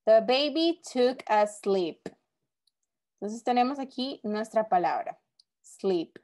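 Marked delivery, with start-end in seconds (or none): 0.0-2.0 slow, deliberate read of the sentence; slow example reading. Shown as a demonstration. Teacher reading an example sentence to the class, slow and clear. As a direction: read it out slowly for the students. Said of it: "Veamos la segunda".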